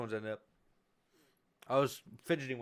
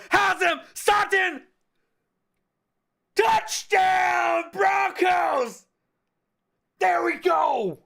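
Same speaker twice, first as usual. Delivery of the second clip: much higher, louder shouted call; touchdown shout; 7 dB louder, much slower than usual. Acted. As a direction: scream the touchdown to the crowd. Touchdown called live.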